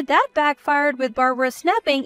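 plain reading voice

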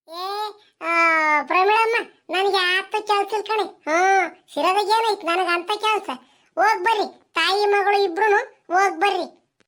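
high, chatty village auntie; curious neighbour calling over the fence. Acted. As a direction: pitch up into the nosy neighbour's voice. Neighbour Sushilamma character voice.

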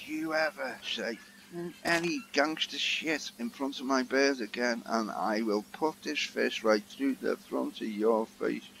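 quiet, controlled menace; menacing gangster threat. Retold or imitated. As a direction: imitate a film gangster threatening under his breath. Acts gangster threat, grabbing Dave by throat.

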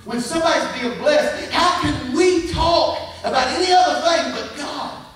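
loud, high-pitched sermon delivery; full-force preaching. Real, not acted. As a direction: belt it out to the whole congregation.

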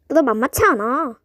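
ordinary delivery

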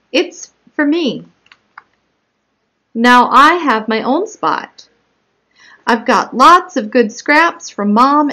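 animated story narration with punched key words; picture-book read-aloud. Acted. Child narrator telling of her own spot, read as lively story narration. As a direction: read it out clearly and expressively to young children.

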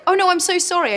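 high, loud, overdone Oh no, I'm so sorry; passive aggressive apology. Acted. A Brit whose foot got stepped on, mock sweet apology. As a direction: apologise sweetly while meaning the opposite. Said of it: "it's a form of passive aggression".

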